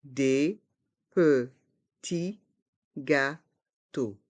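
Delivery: slow "Des petits gâteaux", every syllable apart; learner-paced, split "Des petits gâteaux". Shown as a demonstration. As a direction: separate each sound of "Des petits gâteaux". Teacher breaks phrase into syllables.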